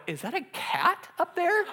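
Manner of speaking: high, soft rising question; small, high-pitched incredulous query; puzzled disbelief. Retold or imitated. Acting out first sight of the rafters, soft incredulity. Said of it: "and I go".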